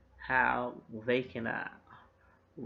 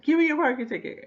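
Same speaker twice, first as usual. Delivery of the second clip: very high, loud, fast mock demand; shouted hold-up demand; 14 semitones higher, 8 dB louder, faster than usual. Annie robbing a couple of their ticket, shouted demand. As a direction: rattle it out as a yelled stick-up. Fake-gun hold-up, acted out.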